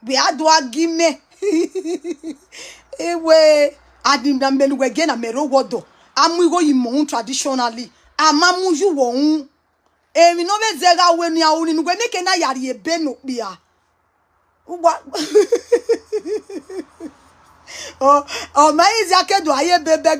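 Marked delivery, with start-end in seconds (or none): 1.4-2.3 quick amused laugh; a brief chuckle; short laugh. Real, not acted. Laughs at own joke. 15.1-17.1 giggly high laugh. Real, not acted. Laughs at own joke.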